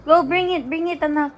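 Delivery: short, very high urging calls. Real, not acted. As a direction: egg a little one on, high and eager. Urging the baby to get it. (masiglang pag-udyok kay baby)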